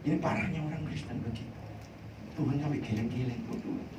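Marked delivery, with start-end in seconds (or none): 3.5-3.8 high, soft, breathy plea as God; a lightly voiced God urging patience; voicing God gently; God speaking. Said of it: "Tuhan ngomong gitu".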